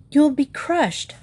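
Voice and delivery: high, drawn-out cry of alarm; yelled warning. A narrator's reading. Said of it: "Dale yelled".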